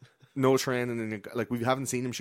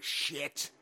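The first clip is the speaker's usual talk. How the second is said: acted dismay; 6 semitones higher, 5 dB quieter than usual. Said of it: "everyone's like".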